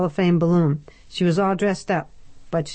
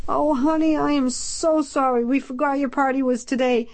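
pleading; 9 semitones higher than usual. A narrator's reading. Ned apologises anxiously.

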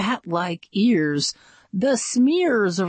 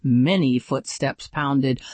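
usual speaking voice

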